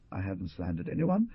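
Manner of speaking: ordinary delivery, nothing marked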